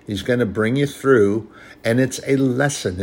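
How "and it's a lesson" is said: ordinary talk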